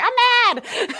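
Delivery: very high, loud whiny I'm mad; mock petulant. Mocking someone who only says they're mad, high loud whine. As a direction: squeak it out like a sulky kid. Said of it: "Just be like".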